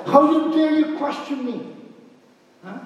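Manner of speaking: high indignant challenge, lightly hammed; mock indignation. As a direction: play the offended one in jest.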